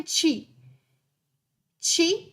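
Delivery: chi said as Italian chee with a hard ch. Shown as a demonstration. Streamer teaching chat the Italian chi, spoken clearly. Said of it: "the ch noise sound is spelled C I".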